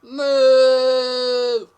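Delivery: big booming cow moo; loud moo. Pretend. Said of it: "That was more like boo".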